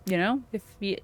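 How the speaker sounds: normal talking voice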